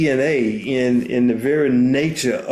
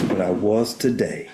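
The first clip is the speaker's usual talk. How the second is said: a touch lower and softer, tender; softened father's voice; 3 semitones lower, 4 dB quieter than usual. Retold or imitated. Father admits his fear to his son, softly and tenderly. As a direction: ease the voice down, gently confessing. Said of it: "And his voice softened even more".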